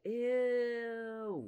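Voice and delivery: a high, grossed-out ew; disgusted ew. Real, not acted. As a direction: squeal of disgust at a plot twist. Reacting to a gross plot twist.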